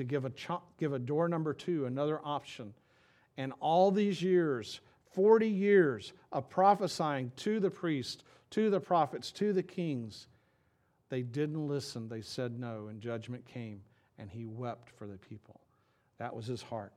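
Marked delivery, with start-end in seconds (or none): 3.4-10.3 slow, louder, higher build; preaching. Acted. Pastor to congregation, building to a climax, preaching. 12.1-16.9 sorrowful drop. Acted. Pastor telling how the prophet wept, falling soft and sad.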